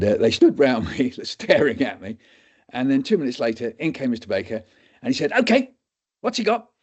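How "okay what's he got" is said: much higher, brisk question; quizzing doctor. Retold or imitated. Doctor tests students again, high. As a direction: demand the answer.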